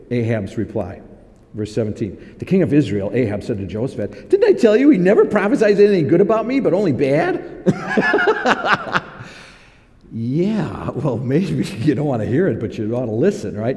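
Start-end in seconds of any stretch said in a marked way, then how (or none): 4.3-7.4 fast, louder, higher indignant whine; whiny complaint. Retold or imitated. Ahab griping to Jehoshaphat, fast mock whine. 7.5-9.3 high burst of laughter. Real, not acted. Preacher laughing at Ahab's complaint, high laugh.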